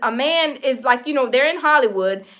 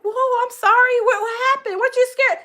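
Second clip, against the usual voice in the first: mock surprised; 9 semitones higher than usual. Host surprised by fear.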